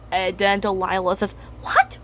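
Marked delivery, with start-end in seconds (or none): 1.6-2.0 startled squeak. Acted. Delilah startled.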